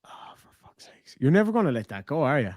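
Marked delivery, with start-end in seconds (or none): 0.1-1.1 breathed out under his breath in exasperation; exasperated whisper. Real, not acted. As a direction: whisper it, fed up. Exasperated at being teased.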